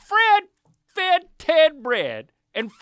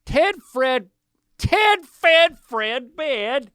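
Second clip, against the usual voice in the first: slow, careful and raised; tongue twister; 6 semitones higher, 4 dB louder, slower than usual. Acted. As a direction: spell out each word slowly.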